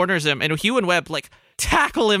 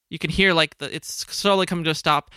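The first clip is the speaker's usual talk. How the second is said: normal talking voice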